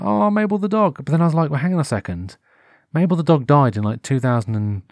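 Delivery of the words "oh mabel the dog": high, mournful oh over the lost dog; a high wail of fond grief; sad sigh. Retold or imitated. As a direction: lament her like a lost friend. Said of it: "I got so sad".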